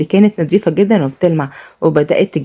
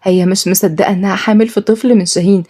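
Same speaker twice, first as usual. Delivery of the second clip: usual speaking voice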